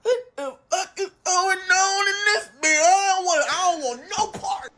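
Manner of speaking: high-pitched strained squeal; acted-out alarmed disbelief; comic panic. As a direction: squeal it, wanting out. Teller's alarmed inner reaction.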